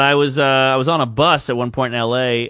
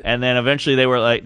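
usual speaking voice

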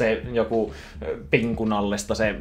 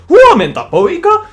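very high, loud cartoon dad greeting; hammed-up cartoon dad call; Goofy voice; 18 dB louder, slower than usual. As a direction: belt it out like goofy Hessu. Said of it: "Hessu tulee ovesta, nakkaa sen infamous linein".

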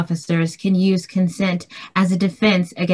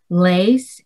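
ordinary talk, nothing marked

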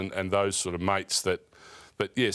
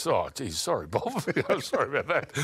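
embarrassed laughing apology; 5 semitones higher than usual. Retold or imitated. Embarrassed apology to the Prime Minister.

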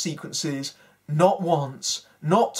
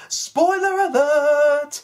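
put-on much higher and louder, slow, hammy German accent; terrible German accent; 9 semitones higher, 6 dB louder, slower than usual. Mocking the snobby German horses, hammy German accent. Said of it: "say lines in a terrible accent".

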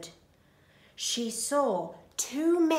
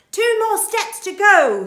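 thief voice; 10 semitones higher, 14 dB louder than usual. Acted. Thief Hugh speaking.

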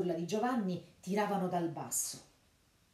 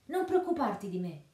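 high, loud call to go; shouted urging; 5 semitones higher, 5 dB louder than usual. A narrator's reading. Robin shouting to Little John to run without him. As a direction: yell it across the yard. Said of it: "Robin gridò a Little John".

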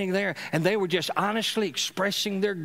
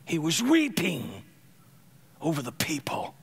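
hushed whisper. A narrator's reading. Preacher telling of Jesus weeping, whispered.

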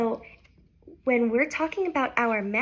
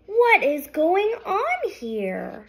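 slow, loud, high farmer's call; startled farmer; 5 semitones higher, 5 dB louder, slower than usual. Acted. Farmer Fred calling out in surprise. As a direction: wake up and demand answers.

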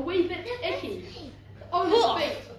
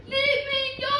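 shouted demand; 8 semitones higher, 5 dB louder than usual. A narrator's reading. Cindy grabbed by the prince, shouting. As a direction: shout it high to break free. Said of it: "As Cindy shouted".

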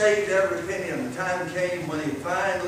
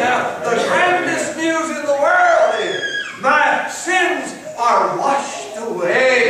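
rising, louder preacher's cry to the congregation; preaching at full force; 7 semitones higher, 5 dB louder, slower than usual. Acted. Preacher to congregation, rising to full force. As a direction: build it up like a revival sermon, slowing on the last words. Preacher shouting the good news.

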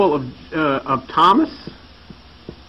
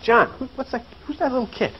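soft, higher-pitched aside to a companion; hushed puzzled question; 5 semitones higher, 8 dB quieter, faster than usual. Retold or imitated. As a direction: ask quietly, as if puzzled by what's out there. Acts James puzzled, asking John.

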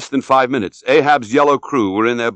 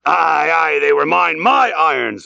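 raised, urgent captain's cry; fervent exclamation with drawn-out opening; Ahab crying out; 5 semitones higher than usual. A narrator's reading. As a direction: claim it excitedly, pitched high. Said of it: "cried Ahab".

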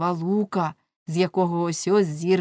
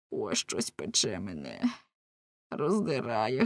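anguished groan; 9 semitones lower, 7 dB quieter than usual. Acted. Lamme in inner torment.

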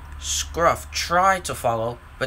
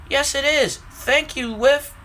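excited; 8 semitones higher than usual. Acted. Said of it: "Ryan was excited".

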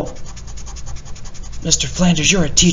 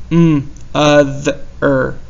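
slow sounding out; much slower than usual. Shown as a demonstration. Teacher blending sounds slowly for the class. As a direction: hum the Mm, then other, very slowly.

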